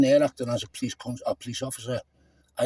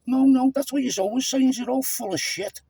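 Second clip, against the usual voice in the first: higher, heated retort; aggressive; 9 semitones higher than usual. Real, not acted. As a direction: snap back with contempt. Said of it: "No, I'm quite aggressive".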